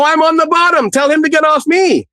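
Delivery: very high-pitched mock yelling; retold desperate protest. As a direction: re-enact a plea from the bottom of a fight, voice way up. Pinned, protesting to the girlfriend.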